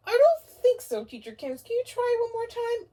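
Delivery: high, loud, eager little owl; puppet owl. Acted. Owl puppet greeting the teacher, high and eager. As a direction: greet like a thrilled puppet friend.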